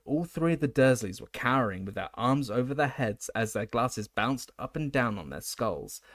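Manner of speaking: plain reading voice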